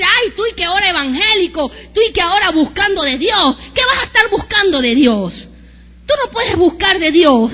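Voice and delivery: loud sneering ridicule; mocking disdain. Relative mocking a new evangelical, loud sneer. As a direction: scoff at the new believer. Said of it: "la voz del menosprecio es decir".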